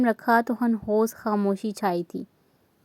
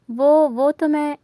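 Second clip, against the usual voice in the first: halting, higher and louder, slow; nervous stammer; 4 semitones higher, 4 dB louder than usual. Acted. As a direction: stumble over the words, caught out. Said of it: "थूक निगलती मुनासिब बहाना सोचने लगी". (घबराकर हकलाते हुए)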